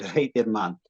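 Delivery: badly faked Scottish accent, drawn out; comic stab at a Scottish accent. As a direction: attempt Scottish for a laugh. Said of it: "do a Scottish accent".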